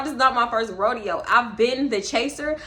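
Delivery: ordinary talk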